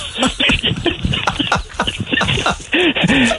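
high, airy laugh; real airless laughing; breathless laugh. Real, not acted. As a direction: wheeze with laughter. Laughing at own story.

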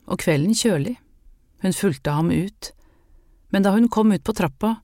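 plain reading voice

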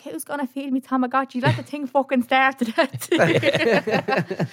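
high-pitched retort, slowing at the end; hammed-up outrage, drawn out on the last line; mock row over grounding. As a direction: act out a heated family argument.

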